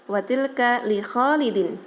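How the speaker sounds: ordinary delivery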